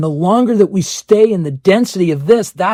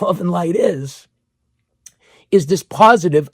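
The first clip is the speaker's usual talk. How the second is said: usual speaking voice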